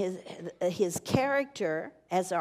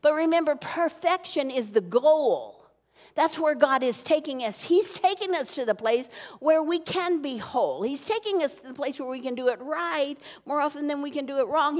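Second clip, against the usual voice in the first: rising, louder, higher preaching; 4 semitones higher, 3 dB louder than usual. Acted. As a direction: build the crowd with rising fervor. Preaching builds up.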